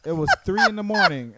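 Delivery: loud, high-pitched objection; indignant protest. Real, not acted. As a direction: raise your voice in real indignation. Protesting being mocked for sleeping.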